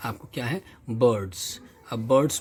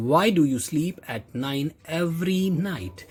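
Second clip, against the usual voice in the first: slow, higher read of the mother's question; 4 semitones higher, much slower than usual. Shown as a demonstration. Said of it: "reads the mother's line".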